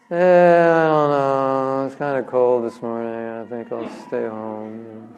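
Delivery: slow, drowsy, dropping to near silence; yawning excuse trailing away softly; lazy excuse. Retold or imitated. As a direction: beg off sleepily, no interest at all.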